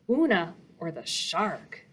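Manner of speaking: usual speaking voice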